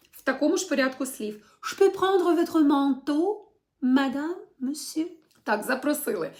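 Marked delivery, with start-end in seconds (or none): 1.6-5.1 "Je peux prendre votre manteau, madame, monsieur?" with the pitch climbing at the end; slow teacher demo with a rising question tune; rising question intonation. Shown as a demonstration. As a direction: ask it with the voice going up on "monsieur". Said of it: "яка йде наверх".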